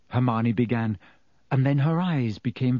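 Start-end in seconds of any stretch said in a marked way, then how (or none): none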